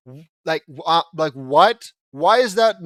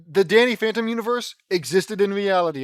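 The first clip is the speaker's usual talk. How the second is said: normal talking voice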